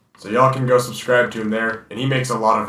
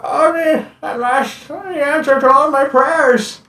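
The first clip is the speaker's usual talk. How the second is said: put-on high, slow elderly villager voice; old man voice; 3 dB louder, slower than usual. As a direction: play a frail old sage, solemn.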